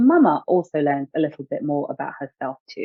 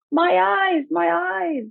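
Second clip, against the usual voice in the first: put-on a little girl with soap in her eyes; mock child scream; much slower than usual. A narrator's reading. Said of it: "Ada screamed".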